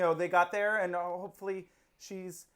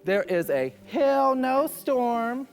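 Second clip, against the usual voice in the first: slow, loud, higher mock delivery; mock clip voice; 5 semitones higher, 8 dB louder, slower than usual. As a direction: ham up the line like the contestant. Imitating the clip line.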